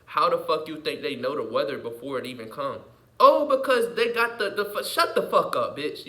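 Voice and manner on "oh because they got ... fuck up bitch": higher and louder, cutting off an imagined doubter; mock angry snap. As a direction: snap at the listener to shut up.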